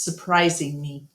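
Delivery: ordinary delivery, nothing marked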